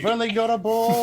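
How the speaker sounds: high, loud imitation yell; mock screaming. Listener imitating Agnikai's complaint about the ball, screamed. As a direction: scream it like the panicked teammate. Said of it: "that was Agnikai screaming".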